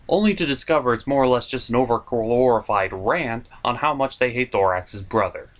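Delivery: normal talking voice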